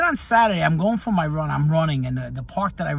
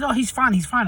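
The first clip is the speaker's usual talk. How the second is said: higher and louder, brushing it off; dismissive mom; 9 semitones higher, 4 dB louder than usual. Retold or imitated. Mom on her phone dismissing the stranger's warning, breezy and dismissive. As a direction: mimic a careless mom waving off the worry. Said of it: "the mom says".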